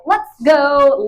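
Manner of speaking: high, loud mock rallying cry; imitating eager dog. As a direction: voice the dog raring to go. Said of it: "he just straight was like".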